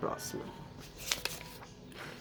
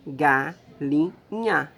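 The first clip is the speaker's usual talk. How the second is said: "Galinha" as ga, lin, nha, spaced out; very slow "Galinha", a gap after each syllable; 4 dB louder, much slower than usual. Shown as a demonstration. Teacher pointing out the last syllable, "Galinha" slowly split. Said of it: "A última sílaba é nha".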